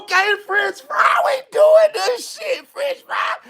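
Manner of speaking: very high, excited acted shout; slow, high-pitched hype; hyped acceptance. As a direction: shout up high to your game buddy. Acted excitement as the game starts.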